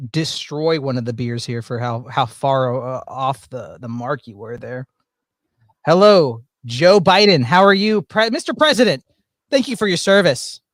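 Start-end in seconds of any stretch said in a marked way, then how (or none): 5.8-10.6 loud, booming mock welcome; mock formal greeting. Streamer greeting the guest as Mister President, loud. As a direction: hail him like a ceremony host.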